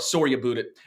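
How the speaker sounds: Canadian "about", said as "aboot"; mock Canadian accent. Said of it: "horrible Canadian accent right there".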